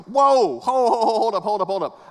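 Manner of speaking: rising alarmed stop-stop, then settling; alarmed whoa. Retold or imitated. Pastor cutting off his son's story, alarmed. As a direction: halt the story in mock alarm.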